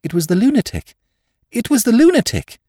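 very high, escalating insistence; wild fatigue. A narrator's reading. Curate insisting on the madman's guilt, with wild fatigue. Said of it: "with a sort of wild fatigue".

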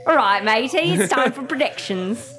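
hammed-up Aussie, matey and all; a terrible mock Australian; bad Australian. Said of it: "That was a terrible Australian accent".

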